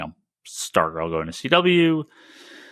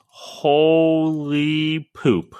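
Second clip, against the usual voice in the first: drawn-out exclamation; 6 semitones higher, much slower than usual. Real, not acted. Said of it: "Dude, you can say shit".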